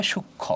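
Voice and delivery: "সূক্ষ্ম" said as shuk-kho, the m silent; ম অনুচ্চারিত. Shown as a demonstration. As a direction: drop the m of "সূক্ষ্ম", doubling into k-kh. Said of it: "তাহলে কি হবে?". ("সূক্ষ্ম"-কে ম বাদ দিয়ে শুক্-খো বলা)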